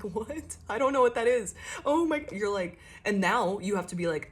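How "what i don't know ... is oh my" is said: high-pitched flustered panic; panic, voice climbing higher; acted panic. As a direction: play someone suddenly lost and panicking. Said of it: "just the panic of".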